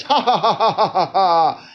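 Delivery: long put-on "ha ha ha" laugh; exaggerated gloating laugh; mock laughter. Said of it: "you can all celebrate and laugh".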